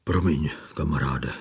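the fat man apologizing to his friend, gravely sad. A narrator's reading. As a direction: apologize heavily, grief in it. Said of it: "řekl vážně a smutně".

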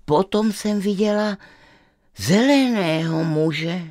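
put-on a curious girl pleading to visit; girl's voice. Stubborn girl tells her parents she is curious, lightly girlish.

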